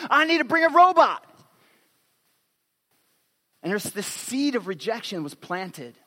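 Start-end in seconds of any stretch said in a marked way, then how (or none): 0.1-1.2 put-on high, loud kid's conclusion; childish insistence. Pastor voicing his daughter's fear of rejection, high and loud. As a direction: blurt it like a desperate little girl.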